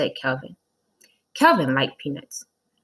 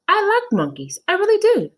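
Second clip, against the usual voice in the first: much higher, loud, insisting; overeager teacher; 6 dB louder than usual. Acted. Teacher insisting she likes monkeys, high and loud. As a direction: gush to prove it. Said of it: "said Missus Jules".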